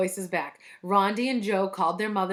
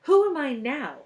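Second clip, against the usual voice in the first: high schoolgirl voice, falling off; Leslie's voice; 6 semitones higher, 5 dB louder than usual. A narrator's reading. Teacher speaks in a pupil's stolen voice, high and girlish. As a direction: pipe up high like a stolen girl's voice. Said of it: "It was Leslie's voice".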